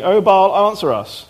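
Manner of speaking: loud cry, then dropping back; calling out to Baal. A narrator's reading. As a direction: cry out to a silent god. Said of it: "called upon the name of Baal".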